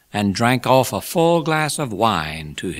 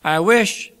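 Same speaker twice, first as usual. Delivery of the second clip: high, raised oratorical start; Pickwick speechmaking; 11 semitones higher, 5 dB louder than usual. A narrator's reading. Pickwick adds a wish to his toast, high oratorical voice. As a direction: pitch it up to the whole company.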